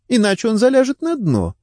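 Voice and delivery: high-pitched, urgent warning; interrupting. A narrator's reading. Wife warns detectives Charles will hide, urgently interrupting. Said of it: "перебила его Делайн".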